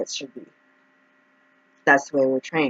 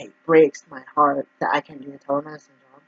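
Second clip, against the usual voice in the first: ordinary talk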